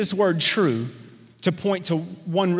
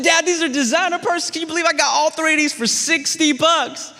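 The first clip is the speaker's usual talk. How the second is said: put-on proud excited teen; 10 semitones higher than usual. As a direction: show off to dad like a kid. Teen boasting to laughing dad.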